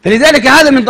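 ordinary delivery

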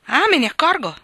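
put-on old aunt's sharp retort; quick retort. A narrator's reading. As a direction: snap back instantly. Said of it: "ribatté pronta la zia".

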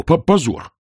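high indignant stammer; stammered outrage. A narrator's reading. Sergeant stammers outrage at the guards. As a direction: spit out the shame, stuttering.

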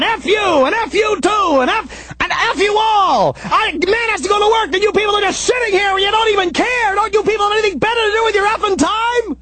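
high, shouted string of curses; angry cursing. Retold or imitated. Angry pedestrian cursing the stopped drivers, high shouting. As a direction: rail at every driver. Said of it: "I'm stomping up the street and I'm going".

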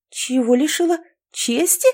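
rising, high "Чести?"; baffled, checking she heard right; puzzled question. A narrator's reading. (недоумённо, с подъёмом на «Чести?»)

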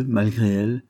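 usual speaking voice

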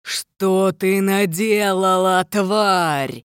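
slow, furious villain roar; enraged dark lord, drawn-out and resonant; frenzied booming. A narrator's reading. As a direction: boom it out in rage, stretching the words. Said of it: "Гвинмар загудел неистово".

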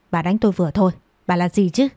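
ordinary delivery

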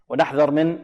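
normal talking voice

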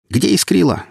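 a thin piping voice demanding answers; squeaky thug demanding from behind a torch beam. Acted. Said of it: "писклявый голос".

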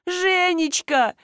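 high, drawn-out howl; wailing call. A narrator's reading. Wife calling after her departing husband, wailing. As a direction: howl the name, long and high. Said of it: "взвыла супруга".

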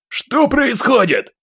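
high, slow panicked cry; panicked shout. Acted. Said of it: "выкрикнул один из офицеров". (испуганный крик)

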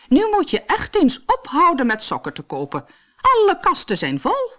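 high-pitched nagging; cross queen. A narrator's reading. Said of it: "heel boos".

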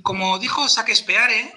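"Shakespeare" read letter by letter as sa-kes-pe-a-re; badly Hispanicized name. Shown as a demonstration. Host showing English spoken badly in Castilian, spelled-out Spanish reading. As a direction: say every vowel of "Shakespeare" as in Spanish. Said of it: "eso queda muy mal".

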